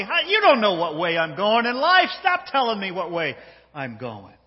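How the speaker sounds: loud, scoffing comeback; mocking. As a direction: brush off a stranger's warning with mockery. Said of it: "He's mocking this guy over here".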